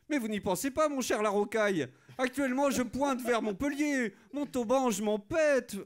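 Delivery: mock-grand courtly bluster; pompous aristocrat. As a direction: declaim like a puffed-up marquis. Satirical sketch, aristocrat voice.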